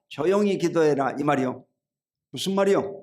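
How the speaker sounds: normal talking voice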